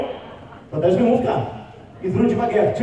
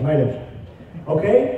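normal talking voice

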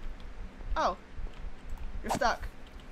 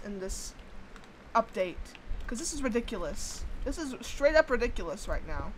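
usual speaking voice

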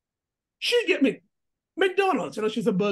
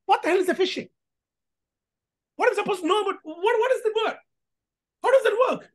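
indignant outburst; 8 semitones higher than usual. Retold or imitated. Old lady scolds the bank about phishing, higher mock indignation. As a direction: act baffled and fed up. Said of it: "tells the bank".

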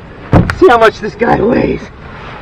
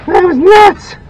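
high, loud, amazed exclamation; 10 semitones higher, 5 dB louder, slower than usual. Real, not acted. Angler to viewers about the wild catch, loud and amazed. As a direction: let the amazement burst out. After the fish leaps.

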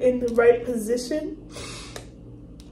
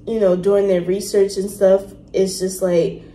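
normal talking voice

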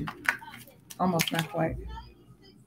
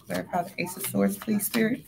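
much softer repeated plea; softer request; 2 semitones lower, 11 dB quieter than usual. Real, not acted. Reader asks Spirit again for clarity, much softer. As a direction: ask it again, quietly.

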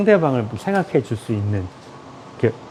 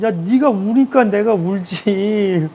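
sister crying along; 7 semitones higher, much slower than usual. Retold or imitated. Acting sister who cries along.

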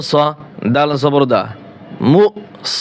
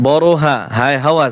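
ordinary talk, nothing marked

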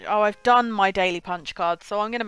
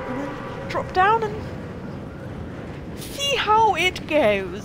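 high, drawn-out excitement; high and slow; 8 semitones higher than usual. Real, not acted. Streamer dropping into the match, high and drawn out. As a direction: stretch it up high as you drop.

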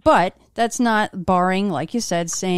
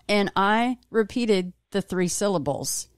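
ordinary talk

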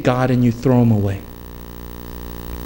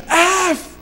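mock scream. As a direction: mimic screaming a curse at another car. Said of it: "he was screaming it out".

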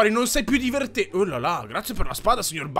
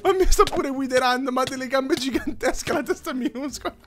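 high, tearful laughing speech; laughing to tears; 5 semitones higher than usual. Real, not acted. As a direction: laugh so hard it almost cries. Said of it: "mi viene da piangere".

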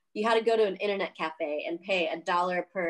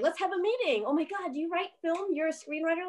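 high, gushing voice of an eager official; mock excited official; 9 semitones higher than usual. Officials gushing to the screenwriter, high and excited. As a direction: play the thrilled official, pitched way up.

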